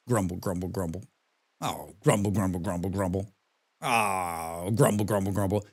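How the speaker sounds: low, soft muttered grumble, grumble; grumbling. Retold or imitated. Sulking in the theater next to his partner, low muttered grumbling. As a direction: grumble under the breath like a sulky moviegoer. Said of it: "like this".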